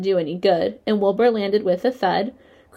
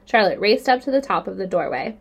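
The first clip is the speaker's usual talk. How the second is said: ordinary delivery, nothing marked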